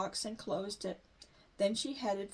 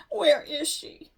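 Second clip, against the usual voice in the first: louder, slow tearful question; crying; 4 semitones higher, 8 dB louder, slower than usual. A narrator's reading. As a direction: ask through tears. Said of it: "Sister began to cry".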